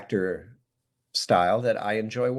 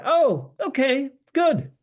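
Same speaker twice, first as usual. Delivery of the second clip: relieved delight; 14 semitones higher, 4 dB louder, slower than usual. Retold or imitated. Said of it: "So I thought".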